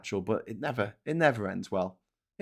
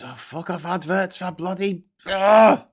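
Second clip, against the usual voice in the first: exasperated groan at an ad; real annoyance, then a loud ugh; fed up with the adverts; irritated outburst; 8 semitones higher, 6 dB louder than usual. Real, not acted.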